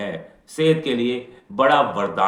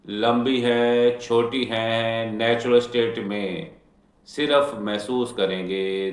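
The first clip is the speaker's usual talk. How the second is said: guided meditation. Acted. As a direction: ease them into stillness, unhurried. Leads a guided healing meditation.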